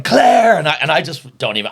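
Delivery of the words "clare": high, loud bellow of Clare; shouted county name. Retold or imitated. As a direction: shout it back across the pub. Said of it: "he was going".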